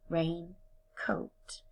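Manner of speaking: hushed, split "raincoat" in a whisper. Shown as a demonstration. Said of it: "break it apart into two separate words".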